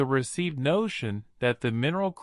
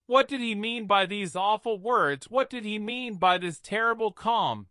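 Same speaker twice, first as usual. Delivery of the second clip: plain reading voice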